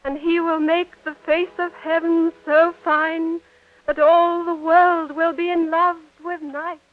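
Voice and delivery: put-on a young girl in love, reciting verse; Juliet. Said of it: "you were Juliet".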